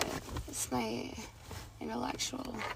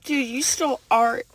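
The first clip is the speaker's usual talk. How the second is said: normal talking voice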